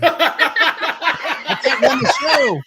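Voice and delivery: high, loud, slow words through laughter; can barely get it out for laughing; laughing helplessly. Real, not acted. Overwhelmed by sour candy.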